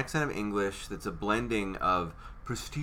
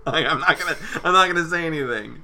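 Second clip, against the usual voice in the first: laughing; 7 semitones higher, 8 dB louder, faster than usual. Real, not acted. Laughing after song.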